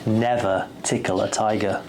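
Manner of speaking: ordinary delivery, nothing marked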